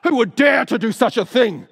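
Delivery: furious shout, high and loud; exploding in anger. Acted. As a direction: erupt at the outrage. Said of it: "The king exploded".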